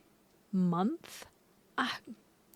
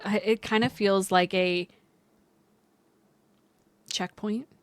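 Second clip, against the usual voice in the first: ordinary talk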